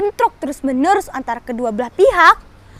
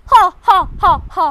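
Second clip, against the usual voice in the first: loud gloating villain laugh; 2 semitones higher, 3 dB louder than usual. Acted. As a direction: cackle with triumph. General gloats.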